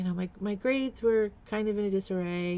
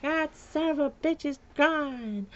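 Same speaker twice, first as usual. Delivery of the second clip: slow, higher put-on voice of the drunk mother; a slow, hammed-up mother voice; mother's retort; 7 semitones higher, slower than usual. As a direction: drag it out like the spiteful mother. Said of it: "And she's like".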